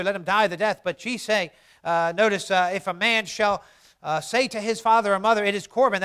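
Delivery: loud, declaimed Bible verses; forceful scripture reading. Acted.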